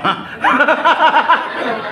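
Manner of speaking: high bright cackle; high laughter. Real, not acted. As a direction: laugh at his answer. Laugh at answer. (mataas na tawa)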